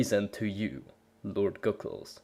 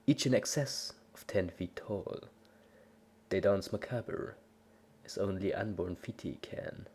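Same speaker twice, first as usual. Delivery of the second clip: softer, hushed, lower dark narration; ominous story narration; 3 semitones lower, 6 dB quieter than usual. A narrator's reading. Narrating a creepy tale to the listener, hushed and ominous. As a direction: draw the listener into something sinister, quietly.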